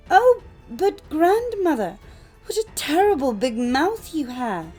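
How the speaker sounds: put-on high, loud child's exclamation; alarmed little girl. A narrator's reading. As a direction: cry out in mounting alarm, pitch swooping. Girl's alarm peaks.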